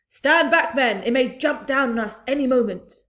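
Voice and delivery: loud, high barked command; urgent shout. A narrator's reading. Police chief shouting at his men, urgent.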